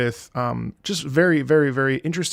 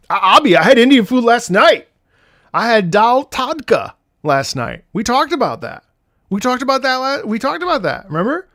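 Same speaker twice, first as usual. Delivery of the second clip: excited; 8 semitones higher, 5 dB louder than usual. Retold or imitated. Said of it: "I was like".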